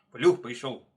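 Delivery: loud alarm call; loud warning from the hares; crying out. A narrator's reading. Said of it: "кричат хором зайцы".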